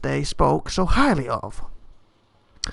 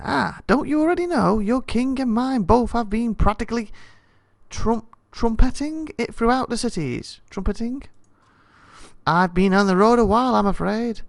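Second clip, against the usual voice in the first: a higher-pitched hint of a prince; lightly voiced prince; 6 semitones higher than usual.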